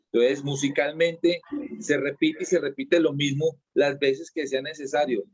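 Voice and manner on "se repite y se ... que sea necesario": ordinary delivery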